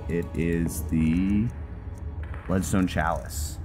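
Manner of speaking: ordinary delivery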